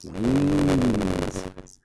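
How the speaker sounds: usual speaking voice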